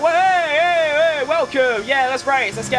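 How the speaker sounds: loud, high-pitched welcome, imitating own streamer self; hyped stream greeting. Streamer imitating how they greet viewers on stream, loud and hyped. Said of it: "I'm all loud".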